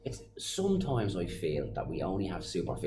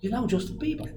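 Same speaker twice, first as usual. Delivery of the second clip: amazed question. Retold or imitated. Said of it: "And I said".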